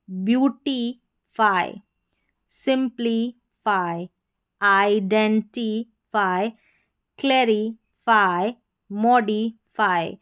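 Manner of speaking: each word said slowly and clearly; slow drill. Shown as a demonstration. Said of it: "teacher drills words slowly". (धीरे-धीरे शब्द दोहराना)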